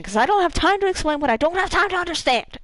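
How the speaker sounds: quick, exasperated Cade. Retold or imitated. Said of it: "He goes".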